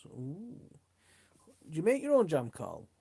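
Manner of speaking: normal talking voice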